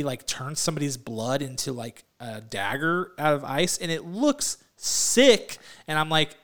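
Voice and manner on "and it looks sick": stretched slow and higher on sick; excited. Real, not acted. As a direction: savor the word like it's awesome. Excited about trailer.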